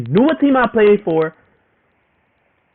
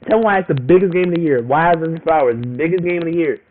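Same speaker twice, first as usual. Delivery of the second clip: ordinary talk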